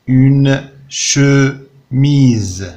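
Une chemise broken slowly into syllables; very slow, each syllable of chemise set apart; syllables tapped out. Shown as a demonstration. Teacher tapping out Une chemise for pupils, slow syllables. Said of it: "Frappe les syllabes".

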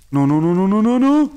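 no's climbing steadily to a high, loud peak; rising siren of panicked no's; escalating panic. Real, not acted. Panic as the fire spreads.

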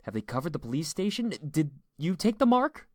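high, loud urgent questions; rising panic. A narrator's reading. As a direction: fire questions, climbing into alarm. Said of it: "Eli was beginning to panic".

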